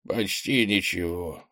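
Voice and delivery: медленная речь старого духа; произнеси медленно, как вздыхающий старик; старик со вздохом. A narrator's reading. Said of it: "вздохнул старик". (медленно, со старческим вздохом)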